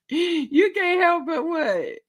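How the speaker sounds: high, drawn-out incredulous question; amused disbelief. Real, not acted. Host answers a viewer's comment, high amused disbelief. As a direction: throw it back in slow, high-pitched disbelief.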